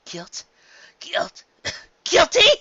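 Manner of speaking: disbelieving, building to a high loud shout; sputtering furious disbelief; stammering rage. A narrator's reading. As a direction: repeat it as rage boils over. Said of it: "Luna stammered in rage".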